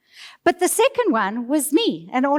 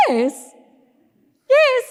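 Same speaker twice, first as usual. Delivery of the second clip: second yes jumps much higher and louder; the group eagerly shouting yes, yes; 13 semitones higher, 4 dB louder than usual. Retold or imitated. Group eagerly agreeing to track.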